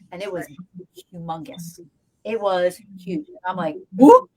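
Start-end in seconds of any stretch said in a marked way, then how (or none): 4.0-4.3 sudden high loud yelp of alarm; a shocked high whoop; startled whoop. Retold or imitated. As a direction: jump at the sight, way up high and loud. Startled at a huge shape.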